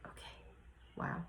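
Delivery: very soft retold inner reaction; hushed realization. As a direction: say it barely aloud, as if to yourself.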